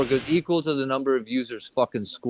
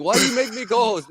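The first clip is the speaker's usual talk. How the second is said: high-pitched, quick mock protest; retold baffled complaint, pitched up; exasperated protest; 8 semitones higher, faster than usual. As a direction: protest in disbelief, fast and high. Retold protest at being made co-host.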